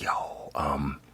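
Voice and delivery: hushed retold aside. Retelling asking about no Ubers.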